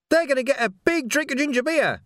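high-pitched, gloating little villain; evil delight. A narrator's reading. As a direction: squeal it, drunk on wicked glee. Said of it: "a voice drunk with evil delight".

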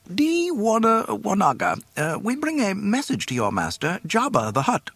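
very high, formal robot voice; official droid. A narrator's reading. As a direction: announce officially and prissily. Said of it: "spoke up officially".

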